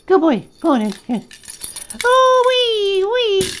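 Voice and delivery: loud, high squeals of encouragement; excited cheering. Real, not acted. Cheering bird grabbing toy.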